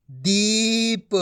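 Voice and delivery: दीप with a long held ee; stretched ee in दीप; long ī, half final. Shown as a demonstration. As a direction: draw out दीप very slowly, light final p. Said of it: "आपको आधा करना है".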